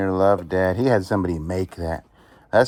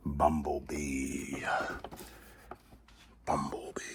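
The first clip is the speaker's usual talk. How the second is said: awed low murmur; 6 semitones lower, 11 dB quieter, slower than usual. Real, not acted. Toy name in awed voice.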